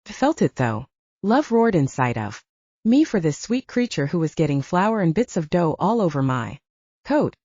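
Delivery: plain reading voice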